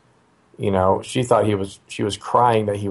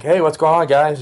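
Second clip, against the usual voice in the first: higher, casual call to the family; breezy greeting; 7 semitones higher than usual. Retold or imitated. Dad coming upstairs to his wife and son, breezy greeting. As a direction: breezily check in on them, pitched up.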